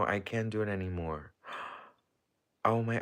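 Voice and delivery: usual speaking voice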